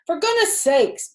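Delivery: loud, high bellow. Acted. Zuckerman bellowing at Lurvy after being drenched. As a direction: bellow in soaked annoyance. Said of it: "bellowed Mister Zuckerman".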